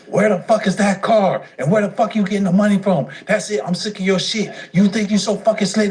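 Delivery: high, mock-angry mother scolding; shrill-free but high, shouting at her son; mother yelling. Mother yelling at her son over the car, high mock anger. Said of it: "So she was like".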